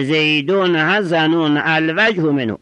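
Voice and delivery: usual speaking voice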